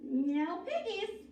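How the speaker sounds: plain reading voice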